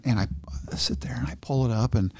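usual speaking voice

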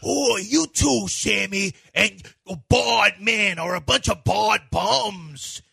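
big, drawn-out actor catchphrase; hammed-up movie bluster, stretching the words slowly; Al Pacino. Actor performing his famous hooah, big theatrical impression. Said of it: "How does he sound when he says".

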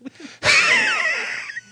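shrieking high cackle, wildly high; Grig laugh. Pretend. Asked by co-host for the character's laugh, high shrieking cackle. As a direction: let out a huge manic high cackle. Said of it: "Do your Grig laugh".

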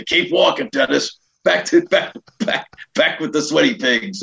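high-pitched teasing call to move along; mock ordering. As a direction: imitate shooing someone down the aisle. Mock heckling from first class.

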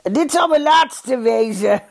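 high, slow, chuckled promise; laughing. A narrator's reading. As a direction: say it through a laugh. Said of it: "lachte Pleunius".

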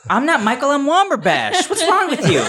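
high, squeaky dwarf voice; high character voice. Acted. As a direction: pitch way up, laughing. Player voicing his character.

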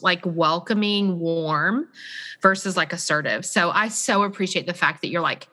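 normal talking voice

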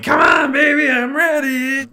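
high, loud leering shout; sleazy creep voice. Retold or imitated. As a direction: yell it like a lecher. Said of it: "just saying".

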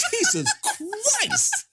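high, slow stunned groan; mock disbelief. Commenting on the casting, slow high disbelief. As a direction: stretch out the disbelief. His own reaction, drawn out.

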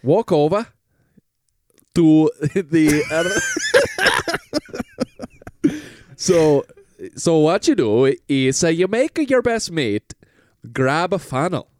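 hammed-up British pitch to the lads; British salesman. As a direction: sell it like a cheeky British huckster. Plays a British Goop salesman.

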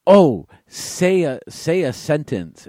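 high, slow mock excitement; gushing, drawn-out imitation; eager request. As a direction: imitate a tourist begging to hear an accent. Imitating people eager to hear accent.

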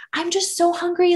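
high, pleading whine about being starving; whiny hungry complaint. Retold or imitated. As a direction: play up the hunger like a sulky kid. Retold snapping at partner from hunger.

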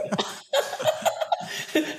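a soaring airy burst of laughter; high breathy laugh. Real, not acted. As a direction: laugh freely at your own line. Laughs after his own saying.

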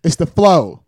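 flow said for floor, no R sound at the end; dropped R. Shown as a demonstration. South Florida guy showing how he says floor. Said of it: "so it's not the floor".

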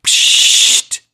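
sharp unvoiced psht burst; a short loud hissing psht; psht noise. Shown as a demonstration. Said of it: "It goes".

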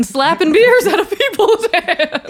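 high, loud and drawn out through laughter; laughing protest. Real, not acted. Telling a friend he made up a false story, laughing protest. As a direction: crack up while protesting the accusation. Teasing mock outrage at accusation.

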